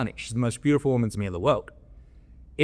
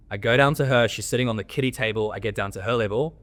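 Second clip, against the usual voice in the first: ordinary delivery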